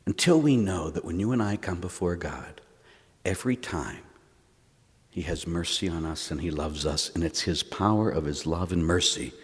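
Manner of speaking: low, soft, solemn sermon voice; hushed preaching. Real, not acted. Preacher on God's love and mercy, hushed and earnest. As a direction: speak it gently, pausing to let it land.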